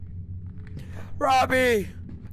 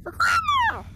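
high battle cry for Grandma; rallying shout. Acted.

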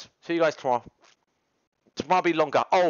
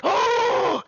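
shocked gasp; 14 semitones higher than usual. Retold or imitated. Classmates gasping at the teacher's word, shocked. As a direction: gasp then high oh.

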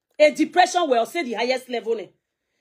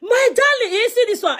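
laughing, teasing; 9 semitones higher, 4 dB louder than usual. Real, not acted. Laughing to viewer.

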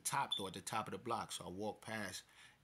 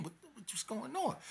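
high-pitched exasperated protest; 13 semitones higher than usual. Retold or imitated. Storyteller replays own dismay at friend's news, exasperated. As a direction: voice shoots up in dismay. Dismayed reaction in retold phone call.

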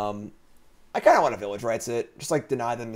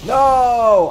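sudden much higher, louder shout; 13 semitones higher, 14 dB louder than usual. Real, not acted. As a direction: yell it in playful protest. Mock outrage at viewer comment.

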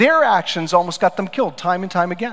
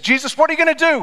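challenging crowd; 10 semitones higher, 3 dB louder, faster than usual. Retold or imitated. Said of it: "When they said".

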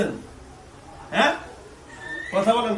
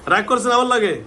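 loud, high-pitched repeated question; insistent questioning; 7 semitones higher, 7 dB louder than usual. Real, not acted. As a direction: press louder for an answer. Repeats question to silent woman.

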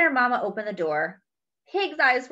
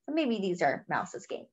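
ordinary delivery, nothing marked

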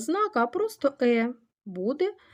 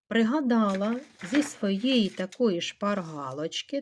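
ordinary talk

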